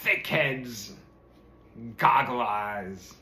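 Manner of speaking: slow, mildly angry name-calling; cursing. A narrator's reading. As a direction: spit out each insult slowly. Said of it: "cursed the frogs and called out".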